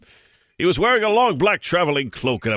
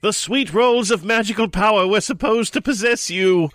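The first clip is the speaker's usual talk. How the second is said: protesting; 8 semitones higher than usual. Acted. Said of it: "Ron protested".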